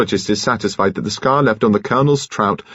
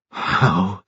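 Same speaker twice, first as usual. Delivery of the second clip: halting, stunned echo; stammered with rage. A narrator's reading. Enraged woman echoes the nurse's question, stammering. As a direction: stammer it, barely getting it out. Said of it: "she stammered".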